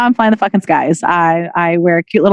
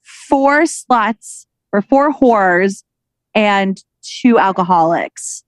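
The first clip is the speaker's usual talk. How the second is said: very slow, drawn-out setup; milk the joke setup slowly; slow, deliberate setup before the punchline; much slower than usual. Shown as a demonstration. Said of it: "It's an aviation joke".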